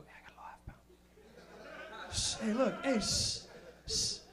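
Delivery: slow hushed whisper, then shushing; secretive whisper. Retold or imitated. Camper hiding his weed from others, whispering and shushing. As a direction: hush it like a secret. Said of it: "You go".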